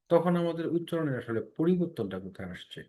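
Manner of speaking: normal talking voice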